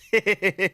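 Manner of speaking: a quick real giggle; amused laugh. Real, not acted. Streamer mocking the slow team renaming, short amused laugh.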